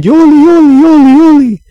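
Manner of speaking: high, loud yoli yoli cry; confused crowd cry. Acted. Icelanders run around confused, calling out high and loud.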